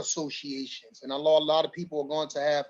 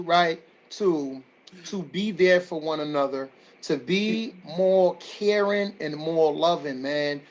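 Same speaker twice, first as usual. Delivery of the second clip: normal talking voice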